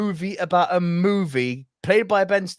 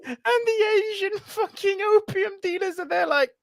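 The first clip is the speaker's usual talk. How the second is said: high, breaking with laughter; laughing through words. Real, not acted. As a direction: speak through a fit of laughter. Laughing while telling.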